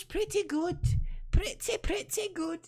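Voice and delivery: very high Scottish accent. Shown as a demonstration. Streamer proud of their own impression, high Scottish accent. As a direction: boast playfully in high-pitched Scottish. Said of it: "I like my Scottish accent".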